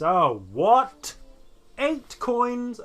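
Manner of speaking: shocked disbelief. Real, not acted. Player shocked at price.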